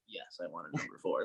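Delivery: sheepish mumble. Retold or imitated. Embarrassed student answering the cashier, soft sheepish. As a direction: shrink into it. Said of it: "I'm like".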